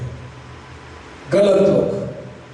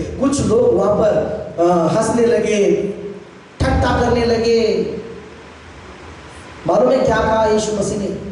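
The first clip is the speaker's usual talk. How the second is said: normal talking voice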